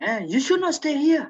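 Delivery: retold warning, pitched high; mildly frightened warning. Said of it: "She was afraid of something".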